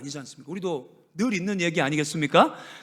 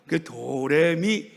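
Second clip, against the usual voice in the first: slow calm tone; 3 semitones lower, much slower than usual. Shown as a demonstration. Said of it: "연습합니다".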